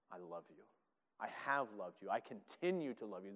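very soft and low, tender; almost breathe the love to them; hushed tender reassurance; tender God. Acted. God's tender first word.

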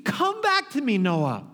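father shouting at his son to come back; desperate pleading shout. Retold or imitated. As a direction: plead with him at full voice, pulling him back. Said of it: "And I would say".

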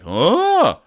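loud, high-pitched startled "huh"; exaggerated noise of sudden shock; startled gasp. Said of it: "Jamal's like all startled".

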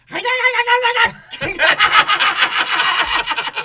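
big high burst of laughter, shouting; a loud laughing outburst at the story. Real, not acted. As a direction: howl with laughter.

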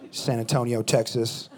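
usual speaking voice

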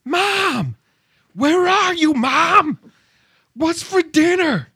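high, whiny full-voice yell for Mom; bratty daughter's drawn-out high yelling. Acted. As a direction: holler through the house like a demanding kid. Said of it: "is yelling".

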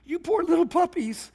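high, laughing mock sympathy for naive newlyweds; mock pity. Pastor mocking the couple who never argued, amused high pity. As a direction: coo it like pitying a small puppy. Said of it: "And I'm like".

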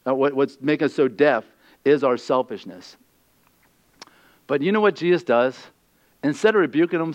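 ordinary talk

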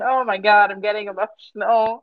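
ordinary delivery

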